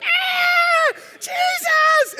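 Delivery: mock girlish shriek, very high; screaming little girl. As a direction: imitate a panicked high scream for help. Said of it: "You sounded like a little girl".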